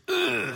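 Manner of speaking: drawn-out "ugh" of loathing; hammed-up disgusted ugh; disgusted groan. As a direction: groan at a hated chore. Disgust at doing dishes.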